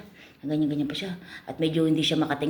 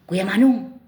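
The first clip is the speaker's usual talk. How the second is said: hailing customer; 5 semitones higher, 6 dB louder, slower than usual. Acted. Pimp hails the customer.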